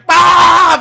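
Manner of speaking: loud, high yell imitating rapid Spanish; hammed-up burst of machine-gun shouting; mock Cuban yelling. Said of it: "machine gunning Spanish at each other".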